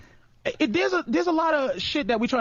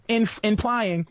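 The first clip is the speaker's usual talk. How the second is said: normal talking voice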